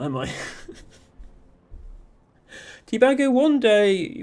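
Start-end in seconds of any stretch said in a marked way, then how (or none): 2.9-4.2 excited. Real, not acted. Eager invitation to a chat viewer.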